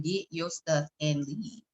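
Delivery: slow, soft command from God; measured, hushed divine order; bass voice. Retold or imitated. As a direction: quietly imitate God's voice, word by word. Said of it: "it was a bass in the".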